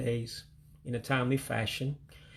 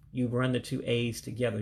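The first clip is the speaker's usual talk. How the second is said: ordinary delivery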